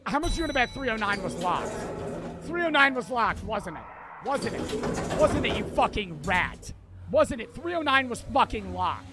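real furious yelling, repeated jabs; furious rant. Real, not acted. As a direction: rage at the rat who locked it. Raging about the locked door.

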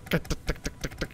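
soft whispered tuk tuk engine noise; puttering engine impression under the breath; imitating lawnmower engine. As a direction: mimic a weak little motor putting along. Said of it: "You hear that thing? It's like".

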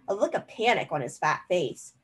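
ordinary delivery, nothing marked

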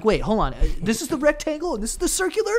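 high, quick flustered questions; baffled mock confusion. Eater puzzling over chocolate box shapes, baffled.

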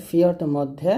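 ordinary talk, nothing marked

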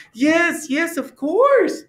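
high, excited confirmation; eager assurance. A narrator's reading. Frog assuring drowsy Toad it is May, high and eager. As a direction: confirm eagerly, pitched well up.

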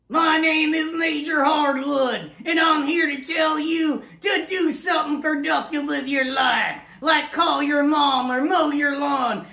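barking drill sergeant, high and loud. Acted. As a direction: shout orders like a drill sergeant. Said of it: "And here he is".

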